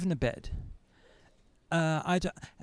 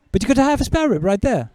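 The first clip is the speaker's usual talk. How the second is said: louder, higher, fast offer of a spare rib; eager host; 8 semitones higher, 6 dB louder, faster than usual. Retold or imitated. Policeman urging the arrested man to eat, eager and fast. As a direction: push the spare rib on him, quick and eager.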